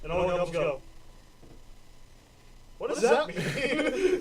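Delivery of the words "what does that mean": high, drawn-out baffled question; hammed-up puzzlement, pitched up; confused. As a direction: ask it slowly in bewildered elf chorus. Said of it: "They're all confused".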